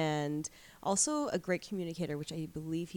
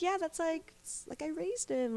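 proud grandma; 11 semitones higher than usual. Retold or imitated. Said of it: "She's like".

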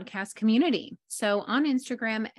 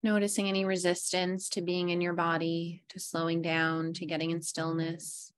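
guided meditation; 3 semitones lower than usual. Acted. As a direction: lull them into stillness, fading low. Leads breathing meditation.

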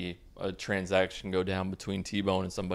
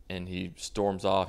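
normal talking voice

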